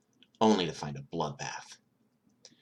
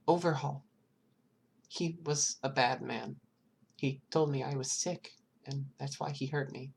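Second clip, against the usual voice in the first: put-on high, timid small child voice; scared child answering a detective, high and hesitant; little girl voice; 8 semitones higher, 4 dB quieter than usual. Eri tells of Overhaul.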